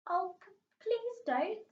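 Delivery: high, slow, frightened pleading stammer. Acted. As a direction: beg her not to, tripping on the words. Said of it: "stammered Chloe".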